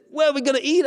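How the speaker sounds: high-pitched, interested question; eager beggar. Acted. Man being offered dinner asks where, high eager voice. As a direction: jump in high, keen to eat.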